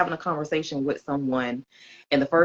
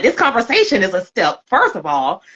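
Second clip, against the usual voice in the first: higher and louder, insistent; loud mock comeback to a friend; emphatic retort; 4 semitones higher, 6 dB louder than usual. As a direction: set the record straight firmly. Retelling her retort.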